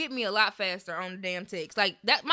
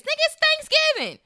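high, fast, outraged disbelief; incredulous shriek; 7 dB louder, much faster than usual. Real, not acted. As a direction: squeal it in mock outrage. Mocking dad's complaint.

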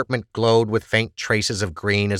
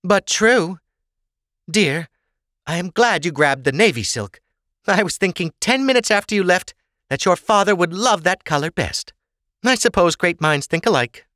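high-pitched, pleased mother; chatty mother; 10 semitones higher, 3 dB louder than usual. Acted. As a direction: chat to your daughter in a raised voice. Sorsha chats with her daughter.

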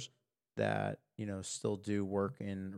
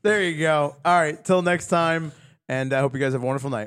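upbeat sign-off; 6 semitones higher, 11 dB louder than usual. Real, not acted. Show sign-off.

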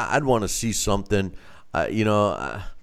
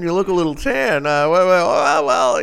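caught out; 8 semitones higher, 6 dB louder than usual. Retold or imitated. Caught with a tan.

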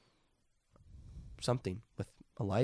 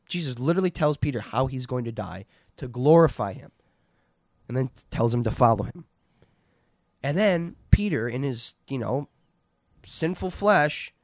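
usual speaking voice

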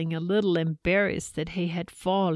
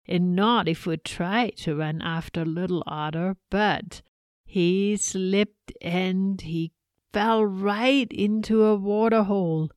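ordinary delivery

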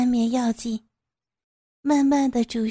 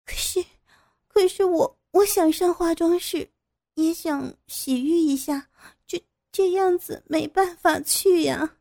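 higher, faltering, flustered voice; embarrassed pleading; 7 semitones higher than usual. A narrator's reading. Captive asks to use the washroom, embarrassed pleading. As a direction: stammer out an awkward request. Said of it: "傅菊英满脸通红".

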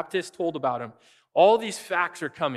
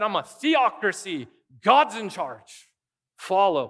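raised, louder preaching voice building to a peak; full-force preaching; 5 semitones higher, 3 dB louder than usual. Acted. Preacher exhorting the congregation, fervent full-force preaching. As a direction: drive the point home from the pulpit.

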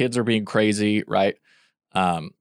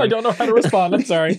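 much higher, louder mock voice; mock apology; 11 semitones higher, 5 dB louder, faster than usual. Friend mimicking husband apologising to crying wife, high. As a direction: parody his awkward apology, squeaky.

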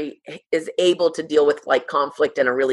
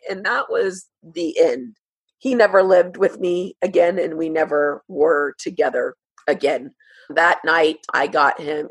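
ordinary talk

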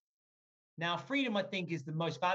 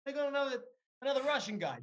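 mock listener surprise; 8 semitones higher than usual. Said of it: "they're like".

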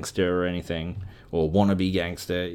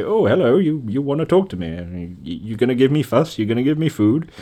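lightly higher, eager cat voice; voicing the cat; 5 semitones higher than usual. Friendly cat approaching her, lightly higher eager voice. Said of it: "as if to say".

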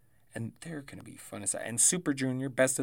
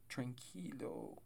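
soft catchphrase echo; 11 dB quieter, slower than usual. Retold or imitated. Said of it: "as Tetsuya Naito would say".

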